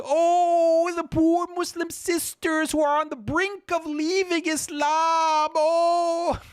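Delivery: very high, whining mock plea; mocking falsetto. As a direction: simper it in a fake pitying falsetto. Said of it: "now she's appealing to".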